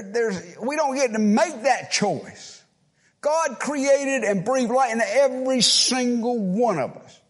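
high, loud pulpit preaching; fiery preaching. Real, not acted. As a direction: thunder the sermon at the congregation. Preaching at full force.

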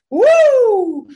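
un uh agudo y muy fuerte, lanzado arriba; grito agudo de demostración. Shown as a demonstration. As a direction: suelta un uh alto y potente para colocar la voz. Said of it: "colocar aquí la voz". (grito agudo y fuerte de ejercicio vocal)

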